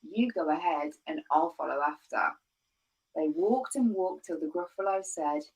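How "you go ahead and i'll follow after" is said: plain reading voice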